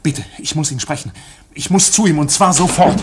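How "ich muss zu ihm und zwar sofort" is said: drängend. Acted. K. drängt erregt.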